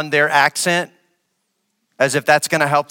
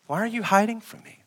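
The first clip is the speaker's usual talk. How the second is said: God calling gently; 6 semitones higher, 5 dB quieter, faster than usual. Retold or imitated. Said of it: "When God presented himself".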